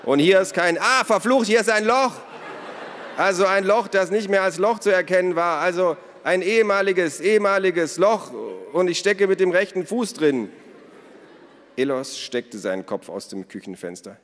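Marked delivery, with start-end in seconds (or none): 0.0-10.5 high, very loud outburst; exasperated shouting. A narrator's reading. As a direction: yell it out as you get stuck. Naru swears, stuck in hole. (laut und aufgebracht gerufen)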